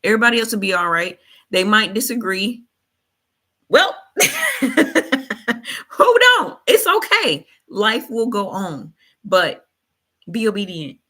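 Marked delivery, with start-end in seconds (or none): 3.7-7.5 laughing it off, much higher and louder; a burst of real laughter then "who don't?". Real, not acted. Laughing at own remark.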